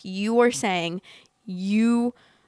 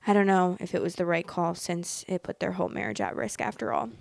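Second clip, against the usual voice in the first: usual speaking voice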